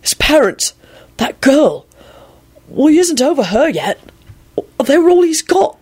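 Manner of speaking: high, halting grief; voice cracking. A narrator's reading. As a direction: break off between phrases, overcome. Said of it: "Arthur's voice cracked".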